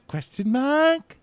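"Question mark" very high and drawn out; mock upspeak. Joking on her upspeak, "Question mark" in high upspeak.

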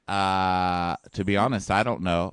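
put-on low voice, noncommittal; mock hesitant guy. Voicing Nick's hesitant answer.

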